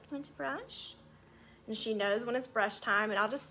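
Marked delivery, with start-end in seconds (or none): 0.0-0.9 soft pet talk. Real, not acted. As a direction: coo softly to your cat. Talking to her cat.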